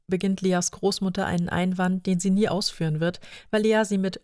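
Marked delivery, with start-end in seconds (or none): none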